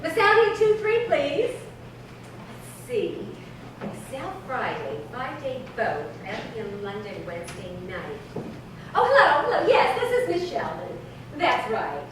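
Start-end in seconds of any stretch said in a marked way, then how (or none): 1.5-8.4 much softer, lower, thinking aloud; muttering to herself. Acted. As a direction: count the days under her breath.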